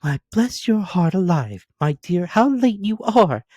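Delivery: put-on a fond mother clucking over her girl; Mrs Cratchit fussing. A narrator's reading. Mother greeting late daughter.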